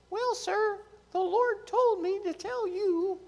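put-on a small boy nervously addressing the high priest; little boy voice. The young boy answering Eli, high and timid.

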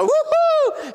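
very high and loud whoop; crowd cheering. Retold or imitated. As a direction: let out a wild cheer. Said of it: "they're going".

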